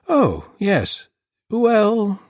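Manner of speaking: high, loud hesitant; flustered host. A narrator's reading. The host stalling when asked about arson, high and flustered. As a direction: caught out.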